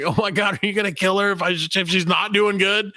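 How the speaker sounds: hammed-up panic; mock panic. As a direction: gasp it in horror. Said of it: "I'm like".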